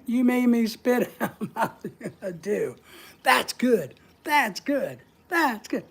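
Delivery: talking through real laughter; laughing. Real, not acted. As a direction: break up laughing mid-sentence. Cracks up at own joke.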